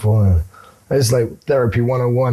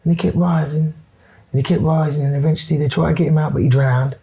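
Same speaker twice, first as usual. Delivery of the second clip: higher put-on voice, faster toward the end; pitched-up impersonation rattling through a story; Charlie Bronson impression; 8 semitones higher than usual. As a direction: tell the grim tale in the mate's high caricature voice. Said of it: "He goes".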